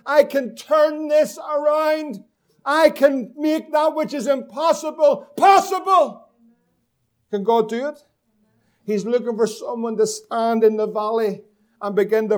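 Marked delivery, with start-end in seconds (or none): 0.1-6.3 slow, loud, higher proclamation; proclaiming shout. Acted. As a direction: shout God's promise to the congregation. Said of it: "We heard it this morning".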